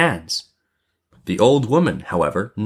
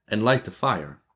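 ordinary delivery, nothing marked

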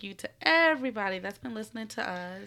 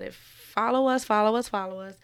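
eager plea; 8 dB louder than usual. Real, not acted. Urging listeners to follow.

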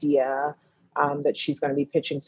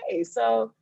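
wry loaded question; 7 semitones higher than usual. Retold or imitated. Friend asks a loaded question.

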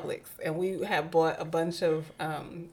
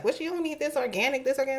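mock elder disbelief; 6 semitones higher, 3 dB quieter, faster than usual. Voicing doubtful parents and grandparents.

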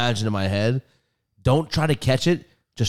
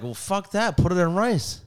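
higher-pitched dismissive comeback; retold retort; 6 semitones higher than usual. Retelling his answer about the wet phone, dismissive retort. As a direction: toss back a mildly indignant retort. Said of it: "I was like".

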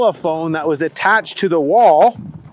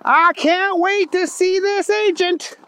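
very high, eager cartoon voice; Booger McFarland; 11 semitones higher than usual. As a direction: anticipate it in a high, goofy voice. Booger looks forward to agent.

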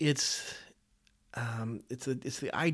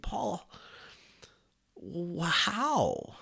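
incredulous question; 5 semitones higher, much slower than usual. Acted. Reader asking Paul how he could write that, incredulous.

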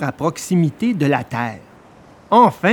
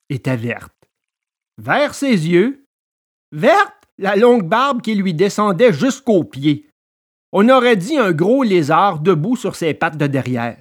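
usual speaking voice

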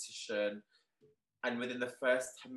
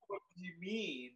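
stunned disbelief; 6 semitones higher, 5 dB quieter than usual. Retold or imitated. Retold shock at the invite.

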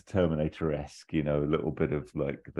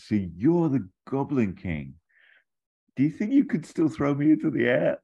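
put-on childlike Toby; 9 semitones higher, 4 dB louder than usual. Grown-up Toby begging the Goblin King, childlike eager voice. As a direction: ask like an excited little boy. Adult Toby asking like a kid.

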